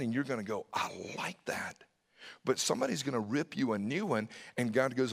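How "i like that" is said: soft whisper; hushed, private approval; whispered reaction. Acted. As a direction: breathe it out under your breath. Listener's inner response to God.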